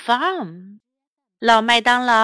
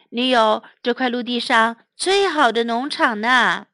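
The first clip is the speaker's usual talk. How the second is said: usual speaking voice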